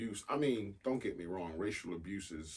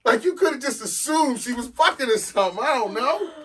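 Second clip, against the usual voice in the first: high, very loud incredulous rant; exasperated rant; 18 dB louder than usual. Real, not acted. Host mocks what co-host got out of that story, exasperated yelling. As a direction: holler it in laughing disbelief. Ranting at co-host's robe question.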